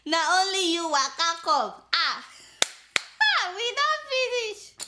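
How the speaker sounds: giggly mock quote; laughing mimicry. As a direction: say it still laughing.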